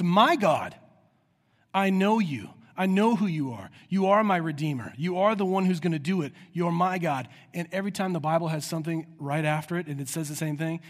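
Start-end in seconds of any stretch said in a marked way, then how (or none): 0.0-7.3 loud, fervent declaration to God; emphatic cry. Acted. Preacher voicing Jesus crying out to his own God, emphatic. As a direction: punch each line with conviction, voice raised. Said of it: "He doesn't just cry out".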